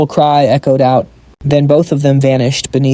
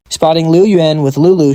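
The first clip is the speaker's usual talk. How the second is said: ordinary delivery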